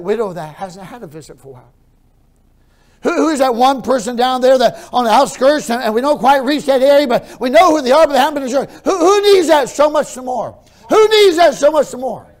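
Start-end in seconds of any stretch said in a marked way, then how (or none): 3.0-12.3 high, very loud preacher's shout; impassioned, high-pitched pulpit roar; full-force preaching. Real, not acted. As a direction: thunder it at the congregation.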